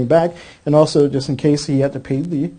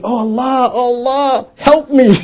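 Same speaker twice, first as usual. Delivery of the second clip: crying plea; 6 semitones higher, slower than usual. Retold or imitated. Driver on the ground begging Allah, crying out. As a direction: beg for help through tears. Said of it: "He's on the ground crying, screaming".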